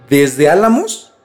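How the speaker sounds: normal talking voice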